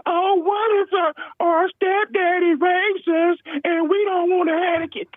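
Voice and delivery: high, drawn-out mocking falsetto; mock whiny excuse. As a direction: put on a high voice to ridicule the excuse.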